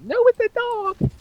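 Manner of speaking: insistent, very high falsetto; creepy woman voice. Pretend. Dog owner arguing it's no rat, creepy high woman voice. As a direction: protest in a creepy put-on woman's voice. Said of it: "the creepiest woman voice".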